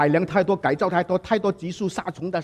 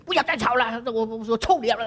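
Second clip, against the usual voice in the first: high strained scolding, no real volume; hammed-up shriek of an exasperated mother; imitated yelling mother; 7 semitones higher than usual. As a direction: act out a mom screaming at noisy kids. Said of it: "声音可以喊到最后一个声音".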